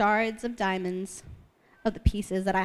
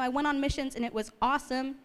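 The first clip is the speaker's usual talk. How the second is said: ordinary delivery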